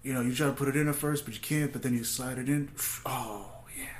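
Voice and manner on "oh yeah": whispered pretend bliss; mock bedroom whisper. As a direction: breathe it out in fake pleasure. Acts out sliding it in.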